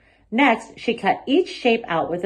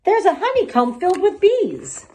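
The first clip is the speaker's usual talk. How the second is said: put-on child's voice; 12 semitones higher, 4 dB louder than usual. A narrator's reading. Child voice spots a shape.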